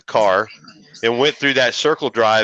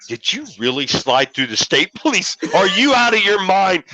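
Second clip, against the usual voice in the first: retold yelling; 7 semitones higher than usual. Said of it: "He started screaming at me".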